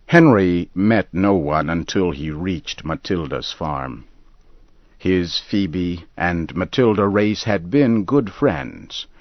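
ordinary delivery

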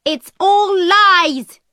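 high, slow furious shout; high angry shout. Acted. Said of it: "my mother shouted".

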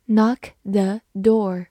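slow, careful knock the door; slow repeat. Shown as a demonstration. Teacher repeats the phrase slowly for learners, slow and clear. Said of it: "example repeated slowly".

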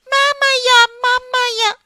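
put-on high wailing child crying for mama; child sobbing for mama in a high voice. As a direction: wail like a small beaten child. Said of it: "孩子哭着叫妈妈".

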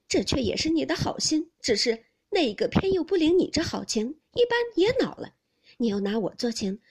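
loud, high, bitter challenge; indignant reproach. A narrator's reading. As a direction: reproach him angrily for meddling. 黛玉继续责问宝玉. (愤愤不平地大声责问)

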